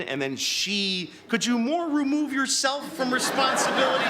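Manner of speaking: ordinary talk, nothing marked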